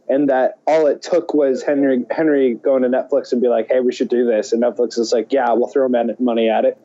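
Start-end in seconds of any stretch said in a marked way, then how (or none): none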